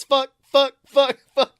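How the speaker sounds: usual speaking voice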